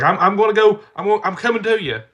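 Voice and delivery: high, loud imitation of a determined child; mock child's insistent yell; panicked six-year-old. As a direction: re-enact a kid hollering urgently. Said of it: "my six-year-old".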